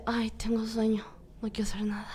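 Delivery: whispered, drowsy complaint; sleepy whisper. Retold or imitated. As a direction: sigh it out half-asleep. Sleepy morning self.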